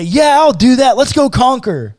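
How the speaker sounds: high, loud hammed-up eagerness; mock eager God. God mocked as eager to conquer, hammed-up high and keen. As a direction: play God cheerfully agreeing to fight. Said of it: "God does not say".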